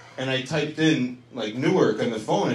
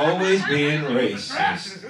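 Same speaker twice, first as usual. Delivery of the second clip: mock-exasperated, slow sing-out accusation; drawn-out mock scolding; 3 semitones higher, much slower than usual. Comic scolding a woman in the crowd, drawn-out mock outrage.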